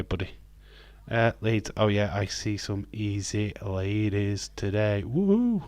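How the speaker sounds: usual speaking voice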